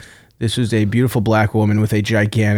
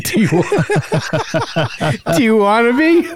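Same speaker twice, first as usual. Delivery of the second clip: laughing through it; 10 semitones higher, slower than usual. Real, not acted. Reading his own flirty message.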